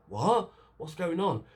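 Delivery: high-pitched, baffled reaction; mock bewilderment. Voicing bewildered reaction to the scene.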